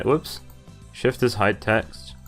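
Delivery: usual speaking voice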